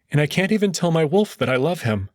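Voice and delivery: ordinary delivery, nothing marked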